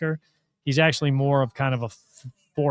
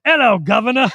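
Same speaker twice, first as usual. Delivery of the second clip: bad Australian accent, loud and high; Aussie accent; 7 semitones higher, 10 dB louder than usual. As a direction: greet him in a hammy Aussie accent. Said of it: "I do my Aussie accent".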